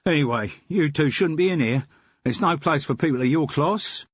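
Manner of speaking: the den keeper dropping the act for his own Cockney; Cockney twang. Acted. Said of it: "in a voice with a Cockney twang".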